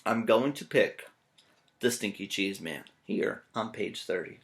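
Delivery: usual speaking voice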